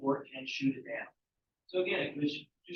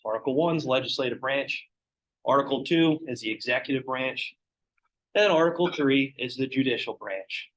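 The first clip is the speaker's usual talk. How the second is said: usual speaking voice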